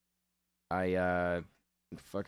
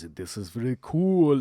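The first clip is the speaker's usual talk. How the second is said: louder, slow fake-excited dad; mock dad enthusiasm; 5 semitones higher, 8 dB louder than usual. Dad faking excitement about his son's belt, mock cheerful. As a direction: overplay the dad's delight at the belt. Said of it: "and he goes".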